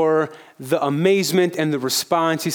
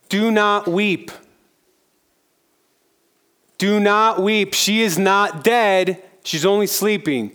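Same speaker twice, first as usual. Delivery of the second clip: firm commanding Jesus; 5 semitones higher than usual. Acted. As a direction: stop the wailing with authority.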